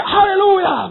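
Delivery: high, loud burst of praise; joyful shout. Retold or imitated. Preacher shows the praise he longs to yell, joyful shout. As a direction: shout it out as joy erupts. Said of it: "saya kepengen teriak".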